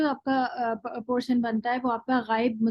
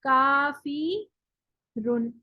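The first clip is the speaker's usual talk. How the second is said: slow demo word; much slower than usual. Shown as a demonstration. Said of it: "इसको डालें तो".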